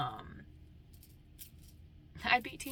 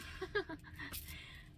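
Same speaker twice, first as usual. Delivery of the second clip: laugh; 11 semitones higher, 7 dB quieter than usual. Real, not acted. Laughing after her song.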